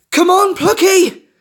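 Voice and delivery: high, loud, slow frantic call; frantic shout. A narrator's reading. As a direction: scream encouragement in panic. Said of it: "Scarlet shouted frantically".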